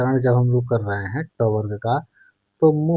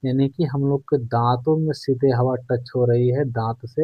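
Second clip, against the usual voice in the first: normal talking voice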